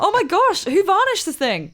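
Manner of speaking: high, loud delighted gasp; excited. Acted. Child marvelling at dad's handiwork, high and loud. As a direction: gush over every detail. Player gushing over gift arm.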